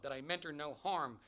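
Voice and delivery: ordinary delivery, nothing marked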